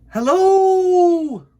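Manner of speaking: long, very high, loud call; shout. Acted. Bear calling up to the moon, loud shout. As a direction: call out across the night. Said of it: "he shouted".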